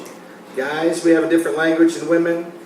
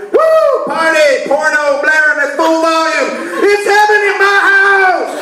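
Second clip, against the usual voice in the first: much higher, whooping party cheer; 12 semitones higher, slower than usual. Real, not acted. Comedian describing wife gone and party time, high whooping glee.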